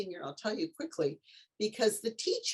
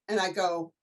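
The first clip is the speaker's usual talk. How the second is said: ordinary talk, nothing marked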